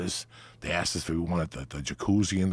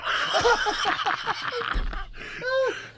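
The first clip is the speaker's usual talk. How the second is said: high laughter. Real, not acted. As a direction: burst out laughing. Laughing at own joke.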